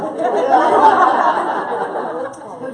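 high, loud airy laugh; real laughter at the crowd; breathy high laugh. Real, not acted. Laugh after the joke.

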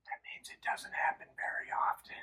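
soft explanatory whisper. Acted. Teacher whispering a definition to kids.

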